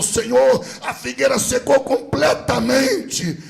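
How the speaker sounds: acted amazement, loud and high; startled disciple. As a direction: call out in acted shock. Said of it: "Pedro se assustou".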